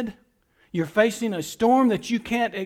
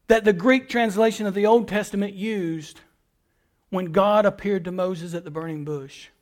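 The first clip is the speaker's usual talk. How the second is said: usual speaking voice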